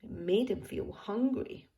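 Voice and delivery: ordinary delivery, nothing marked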